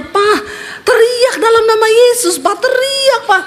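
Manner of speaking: high-pitched urgent cry to husband; urgent pleading. Retold or imitated. Wife urges possessed husband to shout. (seruan mendesak istri, nada tinggi)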